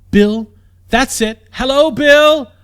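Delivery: calling cheerfully into the woods. A narrator's reading. Princess calls to giant.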